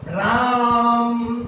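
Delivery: राम with the आ held very long and slow; prolonged राम. Shown as a demonstration. Teacher showing pupils a pluta vowel in राम, held long. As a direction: draw राम out to three beats. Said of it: "तिगुना जो है उच्चारण का समय लग रहा है".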